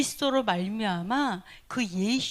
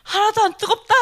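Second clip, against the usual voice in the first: loud, high triumphant yell; defiant cry; 9 semitones higher, 7 dB louder than usual. Retold or imitated. Preacher voicing the young martyr entering the fire, loud and high. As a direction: shout it like a fearless martyr. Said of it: "불 속에 들어가면서 뭐라는 줄 알아요?".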